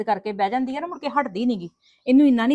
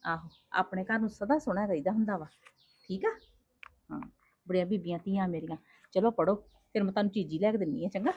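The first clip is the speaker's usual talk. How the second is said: softer, lower motherly coaxing; tender coaxing; 4 semitones lower, 7 dB quieter than usual. Acted. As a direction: soothe the girls gently. ਮਾਂ ਬੱਚੀਆਂ ਨੂੰ ਪਿਆਰ ਨਾਲ. (ਮਾਂ ਦਾ ਨਰਮ ਪਿਆਰ)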